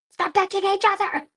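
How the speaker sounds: put-on high, loud teacher yell at kids; scolding the class. Teacher yelling at her grade seven kids, loud scold. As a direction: bark it at a rowdy class.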